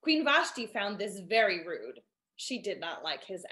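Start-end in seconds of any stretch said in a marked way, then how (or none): none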